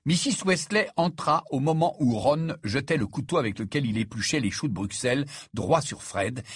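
ordinary delivery, nothing marked

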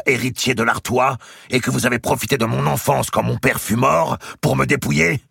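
high, loud angry questioning; Robert's challenge. A narrator's reading. Robert challenging his aunt before the court, high and loud. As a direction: hurl the charge at her.

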